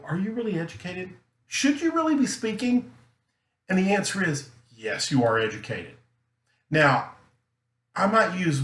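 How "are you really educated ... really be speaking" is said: mock skeptical, climbing high; doubting listener. Listeners question a speaker who made mistakes, mock skeptical. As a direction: question them in a raised, doubting pitch. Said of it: "they go".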